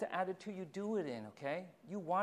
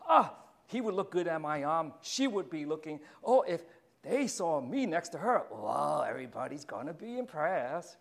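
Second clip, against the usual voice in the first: louder, self-admiring voice of someone picking a date for looks; vain, me-centered; 7 dB louder than usual. Retold or imitated. Preacher mimics a vain dater, louder and self-pleased. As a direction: puff up and brag about the partner on your arm. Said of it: "See, me-centered".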